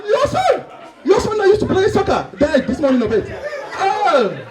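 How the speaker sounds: high, wailing African shock; African accent. Acted. Friend hears the son died, high shocked African accent. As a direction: exclaim in horrified disbelief with an African accent. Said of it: "Two Africans that haven't seen each other".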